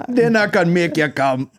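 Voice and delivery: slow, high mock Jamaican lilt; fake Jamaican accent. Host completing the song lyric, slow mock Jamaican accent. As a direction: finish the lyric in drawn-out put-on Jamaican. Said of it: "in a fake Jamaican accent".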